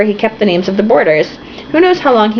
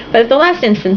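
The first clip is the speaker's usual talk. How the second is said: normal talking voice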